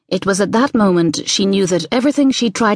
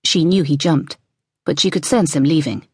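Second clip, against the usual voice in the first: usual speaking voice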